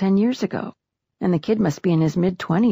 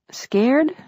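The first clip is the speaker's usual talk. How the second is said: higher, musing echo of a question; thoughtful question; 8 semitones higher than usual. A narrator's reading. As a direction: turn the word over as a rising question. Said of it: "He asks thoughtfully".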